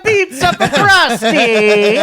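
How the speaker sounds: high, loud mock Italian accent, slow; Italian chef impression. Friend mocks the Simpsons' Italian chef, loud mock Italian accent.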